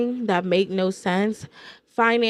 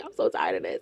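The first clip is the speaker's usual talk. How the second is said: retold crying; 7 dB quieter than usual. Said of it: "I'm crying".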